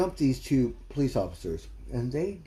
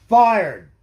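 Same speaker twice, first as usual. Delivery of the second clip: one word yelled much louder and higher; shouted verdict; 7 semitones higher, 13 dB louder than usual. Real, not acted. Ranting about the New York DA, shouting him fired. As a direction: bark it out like a final ruling.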